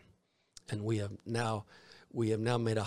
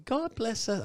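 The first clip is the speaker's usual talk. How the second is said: put-on high, loud little boy's voice; Tiny Tim; 13 semitones higher, 5 dB louder than usual. Preacher imitates the little boy playing Tiny Tim, high and childlike. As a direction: pipe it up like a small child.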